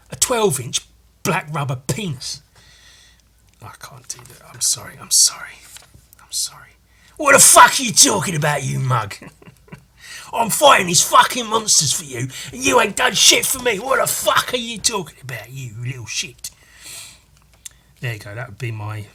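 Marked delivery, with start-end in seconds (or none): 3.6-6.7 breathy sheepish whisper; embarrassed whispered sorry; whispered apology. Real, not acted. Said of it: "unvoiced whisper". 7.2-16.5 higher, louder Cockney rant trailing to a whisper; Cockney accent, furious; Cockney Ultra Mega. Acted. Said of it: "my Cockney Ultra Mega".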